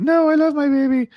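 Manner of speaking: high, loud mock lady protesting; mock high plea. Hosts retell comic, lady refusing the demon baby, high mock plea. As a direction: squeak it out as the horrified lady.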